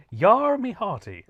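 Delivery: high, slow hammy pirate growl. Shown as a demonstration. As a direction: ham up a comedy pirate. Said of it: "Can you say to me very carefully".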